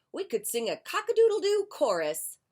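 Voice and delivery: high, loud, quick rooster. Acted. Rooster inviting Duck to a chorus, high and loud. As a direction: crow the offer up high and loud.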